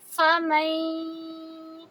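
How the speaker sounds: فَمَن slow with the nasal held two counts; Quran recitation with ghunna. Shown as a demonstration. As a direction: hum the nasal through فَمَن. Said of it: "nasal sound کریں گے". (فَمَن میں غنہ دو حرکہ)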